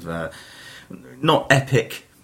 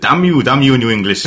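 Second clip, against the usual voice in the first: loud mock Welsh accent, higher; 4 semitones higher, 9 dB louder than usual. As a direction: shout it in a hammy Welsh accent. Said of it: "That's my Welsh impression".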